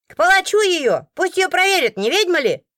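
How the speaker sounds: high, loud jeering demand; hostile woman. A narrator's reading. The robbed woman demanding a witch trial, high loud jeer. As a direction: stir the crowd against the stranger. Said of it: "опять та мадам".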